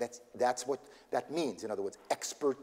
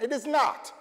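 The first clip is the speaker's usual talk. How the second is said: sharp, high and loud, stress on not; emphatic denial; 10 semitones higher, 6 dB louder than usual. Real, not acted. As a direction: slam the door on the myth: It is NOT. Correcting the American soccer myth.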